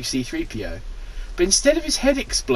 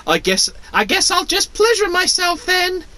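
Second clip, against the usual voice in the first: high Luke voice. Acted. Luke's voice continues.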